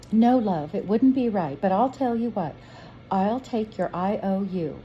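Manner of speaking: kindly shopkeeper, lightly voiced; a motherly shop woman, gently firm; sweet shop woman. Shop woman refusing the boy's watch, lightly voiced.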